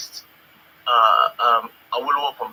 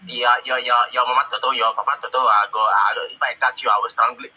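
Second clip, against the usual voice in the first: louder mock-threatening accent; hammed-up menacing accent, louder; mock Igbo accent; 4 semitones lower, 6 dB louder than usual. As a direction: parody the callers' thick threatening accent. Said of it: "crazy accent".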